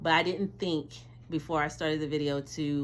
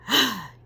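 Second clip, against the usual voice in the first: excited gasp; 6 semitones higher, 7 dB louder than usual. Real, not acted. Reveal moment.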